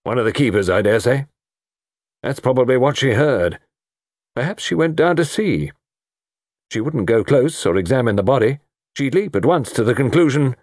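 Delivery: lightly voiced military man; bluff colonel. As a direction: reason it out briskly as a colonel.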